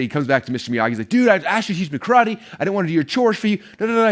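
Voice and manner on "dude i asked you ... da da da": put-on a kid fed up with chores, pleading; frustrated Daniel-san. Daniel-san complaining to Mister Miyagi, higher frustrated whine. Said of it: "Daniel-san's so frustrated".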